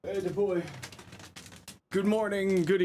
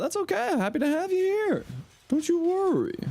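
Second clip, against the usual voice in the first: cutesy reassuring voice. Acted. Reassuring a quiet viewer.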